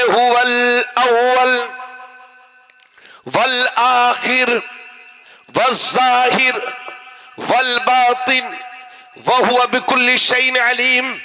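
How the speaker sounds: slow, drawn-out declaimed verse; sustained, solemn recitation; Quranic recitation. Acted. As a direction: stretch each divine name, pausing after it.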